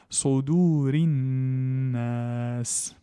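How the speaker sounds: slow chanted recitation; Quranic recitation. Shown as a demonstration. Teacher reciting the verse slowly for students. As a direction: intone Alladhi yuwaswisu fi in long tones.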